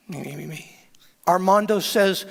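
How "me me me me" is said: very soft mock babble; hushed squeaky gibberish; squeaky background chatter. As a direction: mimic faint jabbering down the line. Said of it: "I can hear Armando in back".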